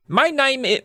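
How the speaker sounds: put-on aborted high-pitched British accent; attempted British accent. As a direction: have a shaky go at British. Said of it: "let's try to do British again".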